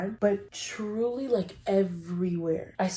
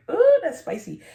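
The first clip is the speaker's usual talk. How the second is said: teasing ooh; 11 semitones higher, 10 dB louder than usual. Retold or imitated. Mock comment on a daring exit.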